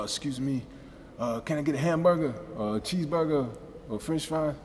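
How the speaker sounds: high put-on Japanese accent ordering food; Japanese-English accent. Mimicking his accent when ordering food in Japan. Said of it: "I can order food easier because with the accent".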